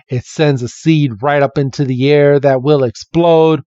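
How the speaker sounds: ordinary talk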